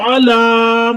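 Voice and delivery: drawn-out علی on a level note; ain from the throat. Shown as a demonstration. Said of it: "عین کو حلق کے نچلے حصے سے ادا".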